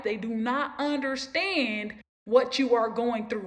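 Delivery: ordinary talk, nothing marked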